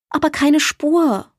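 hoch, laut und langsam widersprechend; räum den Selbstvorwurf entschlossen aus; entschieden. A narrator's reading. (Maya widerspricht Borg entschieden, hoch und gedehnt)

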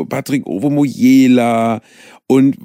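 ordinary delivery

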